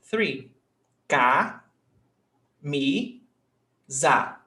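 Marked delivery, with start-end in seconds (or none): 1.1-4.4 ca, mi, za with a gap after each; syllable by syllable. Shown as a demonstration. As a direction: count out the syllables of camisa one at a time. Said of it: "how many syllables have we got".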